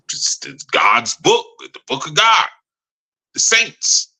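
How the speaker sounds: hesitant mock guessing, starting breathy then jumping high; imitating someone bluffing an answer; making it up. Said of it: "they start making up stuff".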